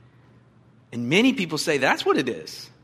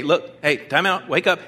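ordinary delivery

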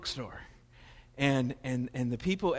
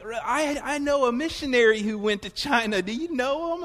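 lightly voiced eager lady, higher; a hint of a clueless shopkeeper; imitating the eager saleslady; bookstore lady; 7 semitones higher, 4 dB louder than usual. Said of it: "And she's like".

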